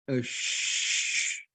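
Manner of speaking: soft unvoiced shh of wind blowing; a long hushed whoosh of wind; wind sound. Shown as a demonstration. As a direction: breathe out like wind through trees. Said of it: "like that".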